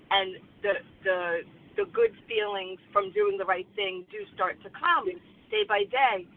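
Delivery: ordinary talk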